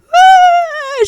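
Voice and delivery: high, loud imitated wail of weeping crowd; imitating churchgoers crying out loud; mock crying wail.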